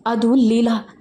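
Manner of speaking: plain reading voice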